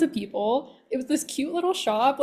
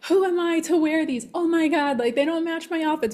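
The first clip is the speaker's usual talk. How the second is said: higher, fussy worrying voice; a woman mocking her own second-guessing; mock self-doubt; 5 semitones higher than usual. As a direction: nag yourself like an anxious shopper. Acts out her own doubting thoughts.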